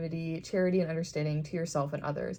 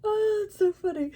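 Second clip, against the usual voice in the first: high, breaking into helpless laughter; cracking up mid-sentence; real laughter; 13 semitones higher, 4 dB louder than usual. Real, not acted. Cracking up at the card.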